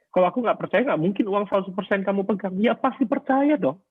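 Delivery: high-pitched protest, rising to the end; argumentative self-defence. Retold or imitated. Husband arguing to his wife over money, high insistent protest. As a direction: re-enact arguing your case, pitch climbing. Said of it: "selalu berargumentasi".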